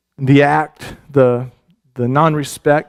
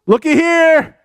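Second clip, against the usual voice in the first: much higher, loud gleeful whoop; excited shout; 13 semitones higher, 4 dB louder, much slower than usual. Retold or imitated. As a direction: crow it out like you've won. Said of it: "David comes to the mouth of the cave and is like".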